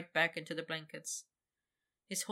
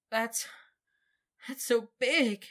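stammering; 5 semitones higher, 5 dB louder, slower than usual. Acted. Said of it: "he stammered stupidly".

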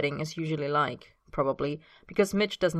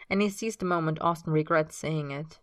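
plain reading voice

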